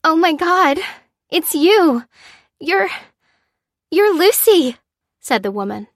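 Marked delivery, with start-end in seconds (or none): 0.0-4.8 high, loud, stunned recognition; disbelief. A narrator's reading. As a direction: falter in astonishment as you recognise them. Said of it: "She stared at Lucy in disbelief".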